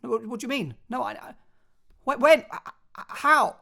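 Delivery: high-pitched, stammering, quick-fire questions; flustered high bursts on a phone call; fast, urgent questioning. Shown as a demonstration. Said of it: "If it's fast and quick and you want more information, you might be speaking like this".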